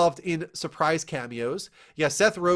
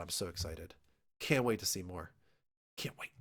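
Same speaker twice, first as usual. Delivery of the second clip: mock excitement; 10 semitones lower, 14 dB quieter than usual. Real, not acted.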